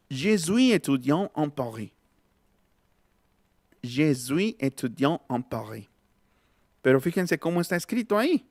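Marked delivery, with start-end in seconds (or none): 0.1-5.9 French example sentence with uvular R in "Paris"; French, nasal vowels, silent final consonants; French pronunciation. Shown as a demonstration. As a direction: model it in careful French. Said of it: "voy a escribir algo en, en francés".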